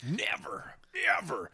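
whispered never. Acted. Whispered refusal.

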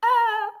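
high, held mock cry; imitated scream. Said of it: "he has him screaming".

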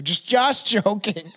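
ordinary talk

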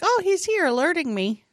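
high-pitched, lightly acted remark; high mock-naive thought; retold realization. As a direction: lift the voice way up. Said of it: "I'm like".

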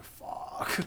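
breathy whispered swear; whispered curse. Retold or imitated. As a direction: whisper it under your breath. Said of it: "I'm like".

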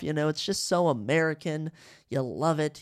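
ordinary delivery

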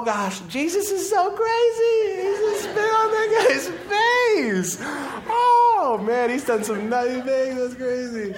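very high, loud, cracking up and wailing; laughing disciples. Pretend. Disciples behind the blind man, squealing with laughter. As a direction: squeal it through helpless laughter.

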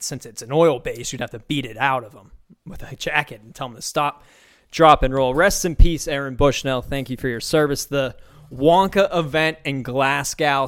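ordinary delivery

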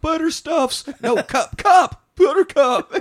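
Harlan crying. Retold or imitated. Sensitive Harlan crying over the police horse, high blubbering. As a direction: wail the name through fake tears. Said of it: "Harlan's like".

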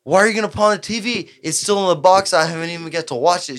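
wife upset about the TV, mildly imitated; wife's complaint, lightly voiced. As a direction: retell her protest with mild pitch-up. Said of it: "And she said".

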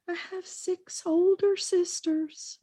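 put-on high, slow childlike voice; young Bill. Man recalling Bill's words in a high, slow child voice. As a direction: play the little boy admitting it, high and slow.

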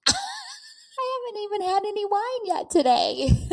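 choked-up laugh, fighting tears; teary-eyed. Real, not acted. Podcaster moved by her own words, laughing through tears. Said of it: "Why am I teary-eyed?".

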